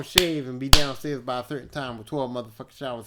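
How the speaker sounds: ordinary talk, nothing marked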